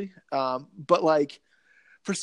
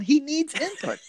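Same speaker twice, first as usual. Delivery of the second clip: much higher, playful insistence; mock urgency; 11 semitones higher, slower than usual. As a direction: push the catchphrase up high for laughs. Repeating the robot's catchphrase, joking.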